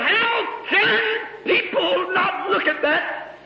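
high, slow cry of wonder; awestruck outcry. Acted. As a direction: throw the question out to the heavens. Preacher cries out in awe.

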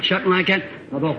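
ordinary talk, nothing marked

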